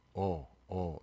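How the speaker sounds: "어" as a rounded aw; "어" as a steady open aw; between O and EO. Shown as a demonstration. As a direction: say "어" halfway between oh and uh, mouth held still. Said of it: "오하고 어 중간 발음".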